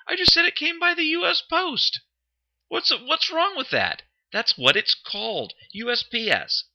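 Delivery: high, indignant protest. Real, not acted. Defending his word choice to his friend, indignant. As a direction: defend yourself, high and offended.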